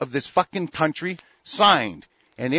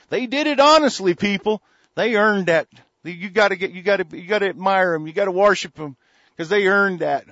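higher, louder mock admiration; sarcastic praise; 5 semitones higher, 4 dB louder than usual. Real, not acted. Mocking the rich internationals, loud sarcastic praise. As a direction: praise them with biting sarcasm.

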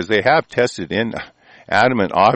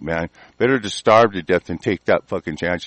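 ordinary talk

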